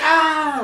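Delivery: high yelp of a falling hero; a sudden high cry as he falls off the roof; mock scream. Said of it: "He's like".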